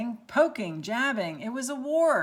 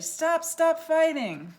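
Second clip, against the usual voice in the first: pleading shout; 7 semitones higher, 6 dB louder than usual. Acted. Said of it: "moaned Pipsqueak".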